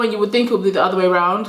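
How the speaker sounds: ordinary delivery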